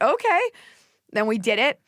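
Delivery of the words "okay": squeaky okay. Retold or imitated. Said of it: "I was like".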